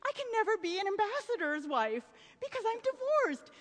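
high, whiny realization; high dismayed voice. Retold or imitated. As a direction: wail it like a spoiled socialite. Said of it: "she thinks to herself".

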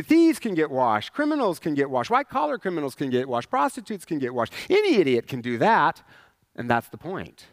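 ordinary talk

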